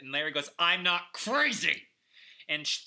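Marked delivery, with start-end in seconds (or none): 0.6-1.8 slow, loud outburst; shouting. Acted. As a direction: yell it in protest. Larry shouts at dinner.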